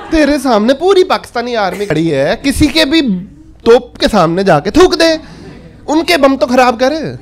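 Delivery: normal talking voice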